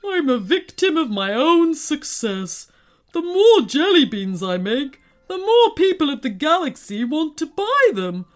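high, put-on giant's lament; woeful lament. A narrator's reading. Tearful candy maker explains the theft, lamenting. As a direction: pour out the woes in despair.

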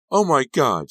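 high, very loud cry; horrified scream. A narrator's reading. Girlfriend falling onto Sal's chest, horrified scream. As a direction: scream out in horror. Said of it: "she screamed".

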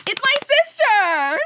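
high, loud, drawn-out reveal; excited announcement. Acted. Announcing special guest.

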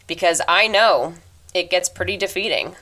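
ordinary delivery

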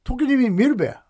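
high-pitched Akita dialect boast. Pretend. Classmate showing off Tokyo life, Akita dialect. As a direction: play it proud in Akita dialect. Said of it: "ちょっと秋田弁で話してみたい".

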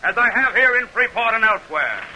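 measured, declaiming public oratory; senator's debate speech to the crowd in measured oratory; formal speech. Acted. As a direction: address the crowd from the debate platform. Douglas closes his debate speech.

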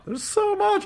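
high, slow squeal of excitement; overwhelmed excitement. Real, not acted. Host gushing to co-hosts about the panel, excited squeal. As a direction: let the words float up, giddy.

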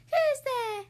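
a startled little mouse calling out; mouse cries out. Acted. Mouse hearing someone at the den, high startled cry. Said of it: "Mouse cries".

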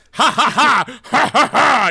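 high, loud maniacal laughter; unhinged villain. Acted. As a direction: cackle like a madman. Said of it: "Good Lord".

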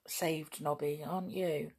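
ordinary delivery

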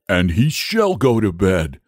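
ordinary delivery, nothing marked

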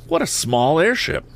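high-pitched, thrilled lad's voice; excited exclamation. A narrator's reading. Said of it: "cried Ned excitedly".

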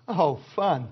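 low, deadpan fake delight; mock lack of enthusiasm. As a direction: imitate a congregation dreading fasting. Congregation's reaction to prayer and fasting.

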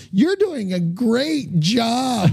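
high, drawn-out mock encouragement; sarcastic praise. Booing crowd forced to be positive, sarcastic sweetness. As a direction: lay the fake niceness on thick, slowly.

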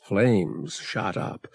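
usual speaking voice

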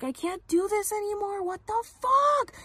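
imitated yelling. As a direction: retell her screaming that she's done. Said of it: "she was, like, yelling".

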